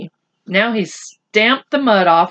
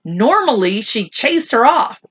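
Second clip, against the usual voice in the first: ordinary delivery, nothing marked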